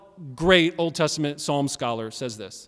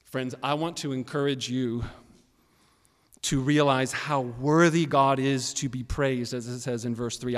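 usual speaking voice

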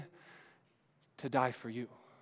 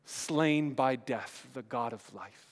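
quoted lyric; 4 semitones lower, slower than usual. Retold or imitated. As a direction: linger on the words. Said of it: "that song we sang".

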